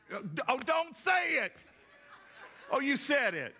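half-shouted, high and playful; a jokey high cry to the room; playful protest. Real, not acted. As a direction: beg the crowd not to spoil it, laughing. Asks crowd not to answer.

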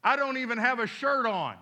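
loud, high-pitched protest; arguing. Retold or imitated. Teenage boy argues with God over witnessing, loud protest. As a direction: object loudly, pleading your excuse. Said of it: "He kinda argued".

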